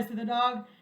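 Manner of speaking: ordinary delivery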